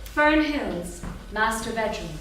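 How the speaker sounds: usual speaking voice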